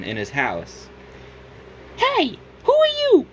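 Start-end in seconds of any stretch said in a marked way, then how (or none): 2.0-3.3 much higher cartoon voice, louder; squeaky startled hero calling out; Cuphead voice. Cuphead startled by a stranger in his house, high and loud. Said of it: "said Cuphead".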